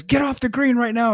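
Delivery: high-pitched mock yell; retold angry yelling. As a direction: imitate an old man ordering someone off. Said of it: "yelling and cussing at me".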